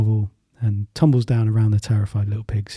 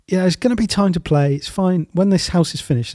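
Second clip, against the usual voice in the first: raised, breezy call; higher calling voice; 6 semitones higher than usual. Acted. Third pig calls to brothers.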